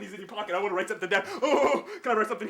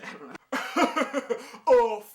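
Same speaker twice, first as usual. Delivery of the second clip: ordinary talk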